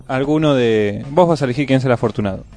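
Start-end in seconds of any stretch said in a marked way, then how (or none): none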